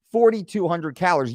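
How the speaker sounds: ordinary delivery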